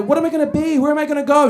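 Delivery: mock the panicky twenty-somethings; imitating anxious young people, hammed-up; whiny twenties angst. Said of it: "people in their twenties".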